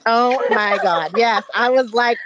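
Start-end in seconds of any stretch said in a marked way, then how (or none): none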